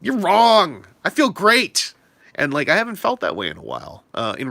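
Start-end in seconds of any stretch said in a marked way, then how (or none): 0.0-1.9 loud, high, defiant reply; defiant shout. Retold or imitated. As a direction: shout it back confidently. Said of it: "my reaction was like".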